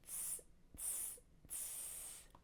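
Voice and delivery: teasing monkey "tss" hisses; three soft voiceless hisses, last one drawn out; monkey chatter. A narrator's reading. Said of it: "shook their fingers back at him and said".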